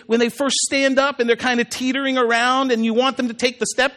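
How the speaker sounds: usual speaking voice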